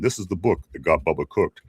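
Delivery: low, deep commercial voice imitation; James Earl Jones. Host explains the Yellow Pages tagline, imitating its deep voice. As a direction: put on a deep announcer voice. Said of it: "with that real deep voice".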